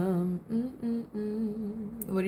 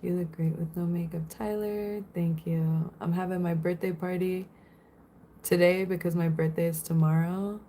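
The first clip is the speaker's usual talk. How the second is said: normal talking voice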